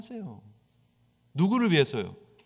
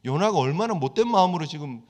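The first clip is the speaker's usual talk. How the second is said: ordinary talk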